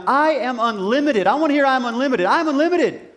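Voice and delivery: building, high-energy rallying cry; rallying yell. Shown as a demonstration. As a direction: show the crowd how to yell it. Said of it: "every single one of you to yell".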